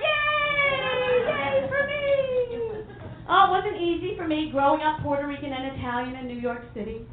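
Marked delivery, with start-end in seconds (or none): 0.0-2.8 mock cheer. Mock cheer after being cured.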